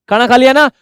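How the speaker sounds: lightly acted mum calling from downstairs; mother calling out. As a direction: raise pitch and volume a little, like a calling mother. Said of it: "mummy बोल रही है नीचे से".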